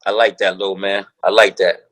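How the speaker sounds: low, easy, approving voice. Retold or imitated. Star putting his arm around the rookie, low and approving. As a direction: drop the pitch and soften in approval. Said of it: "M J walked up".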